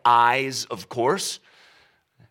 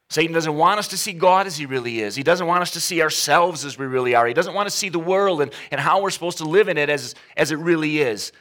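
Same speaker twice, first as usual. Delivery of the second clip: faster, louder rising sermon climax; building preaching; 3 semitones higher, 4 dB louder, faster than usual. Real, not acted. Preacher warns the congregation about Satan, building urgency. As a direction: press on with mounting urgency.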